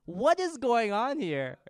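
loud, high, drawn-out disbelief; acted shock. Onlooker at a gory Viking show, acted shock. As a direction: exclaim it slowly, in mock outrage.

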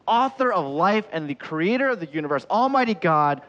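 loud, high, ringing titles; grand proclamation. Real, not acted. Preacher building up who Jesus is, grand proclamation. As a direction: proclaim it like a herald.

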